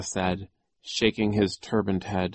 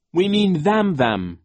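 put-on raised, insistent boy's voice; pointed, emphatic objection; boy's urgent correction; 11 semitones higher, 9 dB louder than usual. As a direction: correct them emphatically, higher and louder. Voices Klaus in dialogue.

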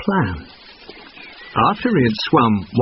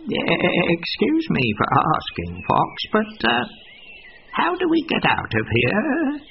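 worried goat; 10 semitones higher, 3 dB quieter, slower than usual. A narrator's reading. Goat realises she's trapped.

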